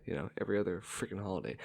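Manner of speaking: usual speaking voice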